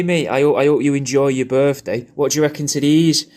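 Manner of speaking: ordinary talk, nothing marked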